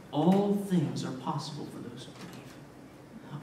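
slow, low and soft; hushed assurance. Retold or imitated. Jesus answers the desperate father, hushed assurance. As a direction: say it quietly, letting each word settle.

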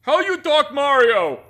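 loud, high, simple-minded; dim-witted brute. Acted. Dim companion asking about Mario, loud and oafish. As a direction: holler it like a clueless oaf.

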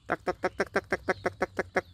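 soft, high quick calling of duck. Real, not acted. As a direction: tap out a high soft chant to lure the duck.

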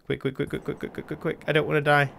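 high rapid-fire stutter into a plea; panicked urgency. Real, not acted. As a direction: chatter frantically, then beg. Racing to base before dying.

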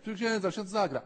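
put-on high, eager kid begging the priest; pleading child. Children nag the young cleric to play, high and pleading. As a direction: beg like an impatient child.

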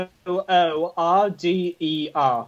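slow, letter-by-letter spelling. Real, not acted. Player spelling the word for a friend, spelling out. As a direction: give each letter its own space, slowly.

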